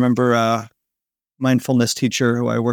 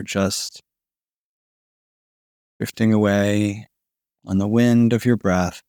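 sleep story narrator; 2 semitones lower than usual. Acted. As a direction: lull them toward sleep, breath by breath. Guided body-melting sleep relaxation.

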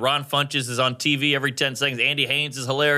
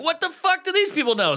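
much higher, disbelieving; high outraged; 11 semitones higher than usual. Retold or imitated. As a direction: shriek it in disbelief. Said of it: "You're like".

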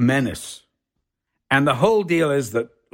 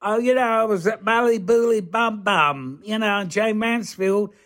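a hint of a comedy-sketch voice, higher pitched; lightly voiced sketch character; 7 semitones higher than usual. Man sitting in the pub with his mate, lightly voiced sketch character. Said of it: "And he'd go".

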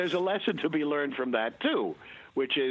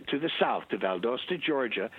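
ordinary delivery

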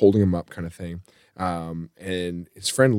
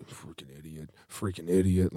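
very soft, low muttered cursing; drunken mumbling; 5 semitones lower, 14 dB quieter than usual. Retold or imitated. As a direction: mutter it to yourself, barely audible. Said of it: "he's mumbling stuff".

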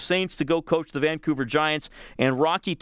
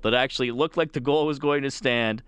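normal talking voice

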